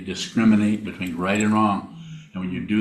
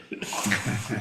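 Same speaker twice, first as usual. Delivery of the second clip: laugh; 6 dB quieter than usual. Real, not acted. Teacher amused by student's name mistake.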